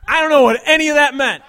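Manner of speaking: loud, high bewildered bro yell; confused shout. Acted. Bro baffled by his buddy's advice, shouting.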